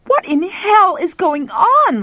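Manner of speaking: high, loud, slow screech turning into a roar. Acted. Lauren demanding answers, screech into roar. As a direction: scream it at them then roar. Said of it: "starting as a screech and ending as a roar".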